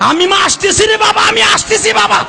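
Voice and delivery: very high, frantic shrieked calls; piercing high-pitched wail in full performance; screaming mother. Retold or imitated. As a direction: cry out desperately to your son as you come. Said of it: "মা চিল্লানি দিয়া কয়".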